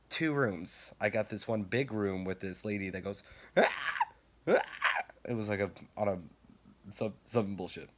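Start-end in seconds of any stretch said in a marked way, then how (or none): 3.6-4.6 two short, sharp imitated screams; quick stabbing yelps, mimicking someone; imitated shrieks. Imitating the lady in the maze room, short shrieks.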